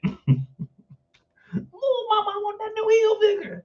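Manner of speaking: very high-pitched mock crying plea; whining child. Child begging Mama for Hilfiger, high mock whine. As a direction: wail it like a begging kid.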